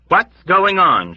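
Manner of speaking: high, loud, slow demand. Acted. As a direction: demand an answer. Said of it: "she demanded".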